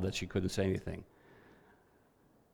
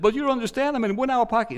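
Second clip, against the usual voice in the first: much higher, pleading protest; panicked plea; 12 semitones higher, 4 dB louder, faster than usual. Retold or imitated. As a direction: cry out in a high voice, desperate to be understood. Upset woman voiced in story.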